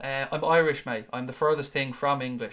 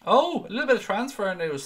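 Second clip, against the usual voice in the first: higher, louder, hyped-up announcer call; announcer flourish; 7 semitones higher, 5 dB louder than usual. Acted. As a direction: announce it like a big reveal. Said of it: "Sound that transfer klaxon there".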